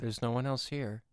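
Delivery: low, disembodied inner voice; voice of consciousness. Pretend. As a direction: speak low as his inner voice. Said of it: "it's the voice of your consciousness".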